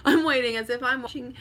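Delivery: real giggle at your own mix-up; laughing. Real, not acted. Laughing at self for waiting on live viewers, amused.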